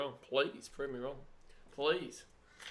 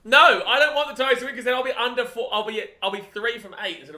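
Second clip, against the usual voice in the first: high, raised-voice objection; loud protest; 8 semitones higher, 11 dB louder than usual. Real, not acted. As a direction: object loudly at a raised pitch. Protesting against a Tigers win.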